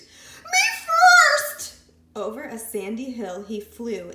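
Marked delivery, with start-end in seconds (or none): 0.0-1.8 very high, loud shriek. A narrator's reading. Piglet shrieking as he gallops over the hill. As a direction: scream it at full gallop. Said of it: "Pinkerton shrieked".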